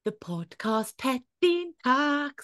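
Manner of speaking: drawn-out jingle-style intro, rising high on the name; stretched, sing-out announcer call; segment announcement. Pretend. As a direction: announce the podcast pet like a game-show act. Announcing the podcast pet segment.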